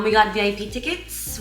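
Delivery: usual speaking voice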